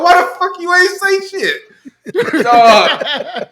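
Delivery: high, drawn-out indignant yell through laughter; slow, high-pitched laughing outrage; cussing someone out. Retold or imitated. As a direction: reenact scolding a friend while fleeing. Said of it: "I'm cussing E out as I'm passing him".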